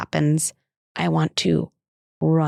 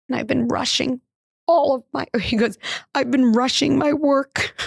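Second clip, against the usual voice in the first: put-on higher, lightly childlike confession; imitating her son; 6 semitones higher than usual. Mother retelling her first grader's confession, higher child voice. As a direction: play the little boy owning up, pitched up. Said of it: "And he goes".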